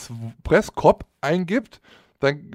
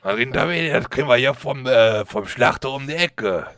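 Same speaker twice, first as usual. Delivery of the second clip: lightly voiced rough butcher lady; a hint of a brash shop woman. Plays butcher woman Bertha.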